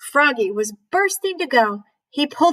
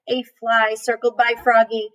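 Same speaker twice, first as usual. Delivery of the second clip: plain reading voice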